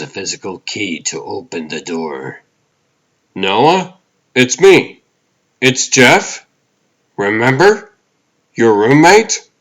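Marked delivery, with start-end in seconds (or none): 3.3-9.5 high, loud questioning lilt; coaxing roommate. Acted. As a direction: call out to a wary roommate, each phrase rising. Coaxing a wary roommate at the door.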